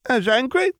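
put-on high little boy; toddler voice. A narrator's reading. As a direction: ask like a small child. Ben asks.

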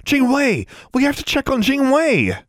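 high, urgent cry; gasping alarm. A narrator's reading. Girl realizing the owl may be in danger, gasping. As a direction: blurt it out in sudden alarm. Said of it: "Alexandra let Charlie go and gasped".

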